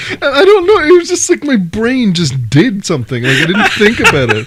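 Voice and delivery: talking through real laughter; words breaking up with laughs; laughing. Real, not acted. Laughing as he explains his brain's slip.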